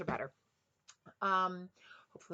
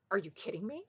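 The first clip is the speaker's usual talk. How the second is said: ordinary talk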